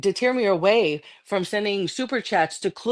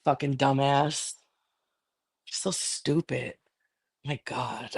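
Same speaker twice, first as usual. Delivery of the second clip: soft, slow, lower grumble of contempt; disgusted mutter; 6 semitones lower, 6 dB quieter, slower than usual. Real, not acted. Streamer mutters to herself about her rival, quiet and disgusted. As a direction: mutter it under your breath.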